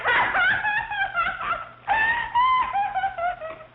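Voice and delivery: high, loud triumphant shriek of laughter. Acted. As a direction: burst into a gleeful shrieking laugh. Triumphant laugh after the taunt.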